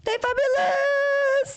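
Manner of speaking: very high, drawn-out dog voice; squealed, slow pretend-dog catchphrase; talking dog voice. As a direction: stretch it out slowly, way up high. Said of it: "Il faudrait qu'il dise «T'es fabuleux sans chien»".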